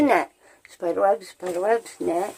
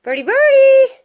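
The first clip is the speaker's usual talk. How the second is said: calling out; 3 semitones higher, 5 dB louder than usual. Real, not acted. Calling for hidden bird.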